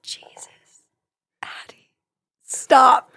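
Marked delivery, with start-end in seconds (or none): 0.0-1.9 barely voiced astonishment; awed whisper. Real, not acted. Co-host whispering in awe at a profound line. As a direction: whisper it, stunned and quiet.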